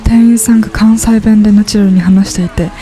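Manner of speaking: ordinary talk, nothing marked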